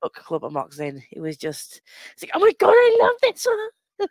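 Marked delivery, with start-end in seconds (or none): 2.3-4.1 high, loud, gushing kid impression; imitating excited child. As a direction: squeal it like an overexcited little boy. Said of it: "I love how excited he got".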